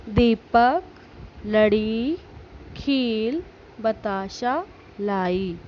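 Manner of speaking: slow, measured reading of a school text; teacher reading the lesson aloud. Shown as a demonstration. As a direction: read each phrase slowly for children. Reads lesson text slowly.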